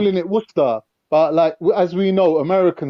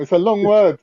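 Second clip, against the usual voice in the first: normal talking voice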